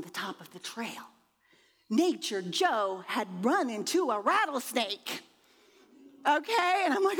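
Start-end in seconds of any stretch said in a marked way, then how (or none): none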